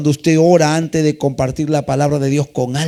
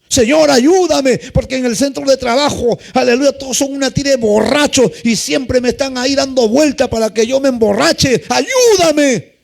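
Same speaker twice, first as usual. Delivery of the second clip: 1 pleading prayer; 6 semitones higher than usual. Shown as a demonstration. Sample prayer for help.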